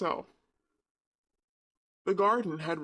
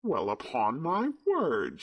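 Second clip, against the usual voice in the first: slow astonished Yorkshire woman; a touch of broad Yorkshire, amazed; slower than usual. A narrator's reading. Mrs Medlock overhearing the laughter, astonished broad Yorkshire. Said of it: "speaking rather broad Yorkshire herself".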